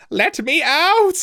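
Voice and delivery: high, loud, slow desperate yell; locked-in boy crying out for the crew, desperate yell. A narrator's reading. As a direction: pound on the door and cry out. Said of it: "he cried".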